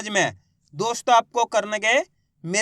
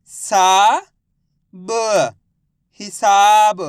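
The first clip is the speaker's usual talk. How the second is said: loud, drawn-out सा, separate ब, then a stretched हिसाब; teacher sounding out हिसाब for pupils to repeat; syllable-by-syllable reading; 6 dB louder, much slower than usual. Shown as a demonstration. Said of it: "मेरे साथ साथ इनको repeat करना है". (सा, ब अलग-अलग, फिर हिसाब खींचकर ज़ोर से)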